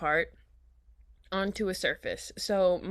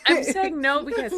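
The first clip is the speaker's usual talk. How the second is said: laughing; 6 semitones higher, 9 dB louder than usual. Real, not acted. Still laughing after coughing.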